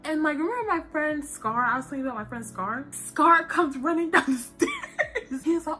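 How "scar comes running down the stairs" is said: slow, laughing through it; can't stop giggling. Real, not acted. Telling how her friend ran down, laughing.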